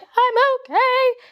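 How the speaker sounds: very high, slow fake-bright reassurance; forced okay. Crying in public and telling strangers, high and fake-bright. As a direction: pretend you're fine while crying. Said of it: "you're like".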